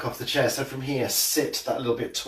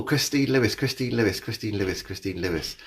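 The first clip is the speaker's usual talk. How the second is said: fading repetition; 4 dB quieter than usual. Pretend. Echoing a viewer's name, fading each time. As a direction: let the name die away.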